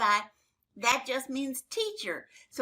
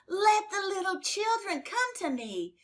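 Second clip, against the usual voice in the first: Jesus repeating his command, higher and more urgent; higher, insistent repeat; 7 semitones higher than usual. Acted. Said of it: "Jesus got very angry at the disciples".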